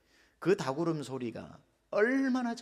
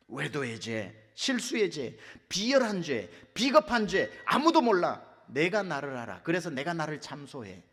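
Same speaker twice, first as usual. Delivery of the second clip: ordinary talk, nothing marked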